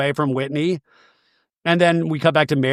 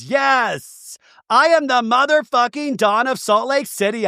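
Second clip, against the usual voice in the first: high-pitched, emphatic retold boast; mock raise-the-roof boast; 8 semitones higher, slower than usual. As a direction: declare it high and proud, imitating a reality star. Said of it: "she's raising the roof as she says it".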